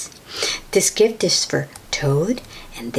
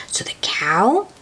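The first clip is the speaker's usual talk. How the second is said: ordinary delivery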